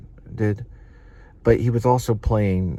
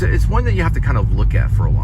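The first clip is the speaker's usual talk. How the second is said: ordinary delivery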